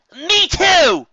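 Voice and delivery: high, loud nervous shout; shouting back. Acted. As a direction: yell up to the sky, half scared. Trixie shouts back upward.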